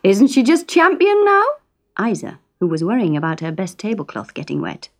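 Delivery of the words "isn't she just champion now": high, loud boastful Geordie father; proud Fred. A narrator's reading. Fred boasting to his wife about the mermaid, high and loud. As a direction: show off the catch proudly. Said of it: "he said".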